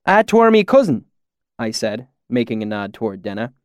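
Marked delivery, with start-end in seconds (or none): 0.1-1.1 higher-pitched imitation of rustic valley accent; put-on valley dialect. A narrator's reading. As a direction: slip into the swineherd's country dialect. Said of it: "my sudden change in dialect".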